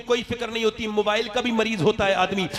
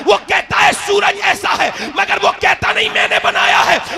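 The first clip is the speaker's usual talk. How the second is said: higher and louder, shouting the sermon; fiery preaching; 8 semitones higher, 10 dB louder than usual. Acted. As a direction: thunder it out to the crowd. Preaching at full force.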